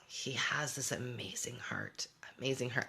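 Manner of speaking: tender whisper. Real, not acted. Mom praising her son's heart, in a soft whisper. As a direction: hush it out with tenderness.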